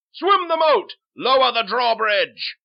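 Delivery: high, loud shouted orders; rousing full-voice shout; mob's battle cry. Acted. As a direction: yell it to a charging crowd. Angry mob storms the palace.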